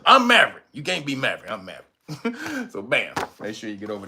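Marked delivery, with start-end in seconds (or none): 0.1-0.6 mock boast shout. Insisting on being Maverick.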